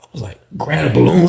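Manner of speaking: usual speaking voice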